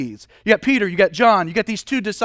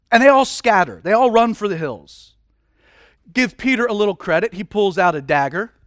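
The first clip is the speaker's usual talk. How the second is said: ordinary delivery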